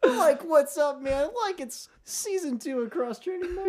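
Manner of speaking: high, giggly stoner dude; Cheech-style stoner voice. Pretend. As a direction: stoner buddy, high and laughing. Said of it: "You sound like Cheech".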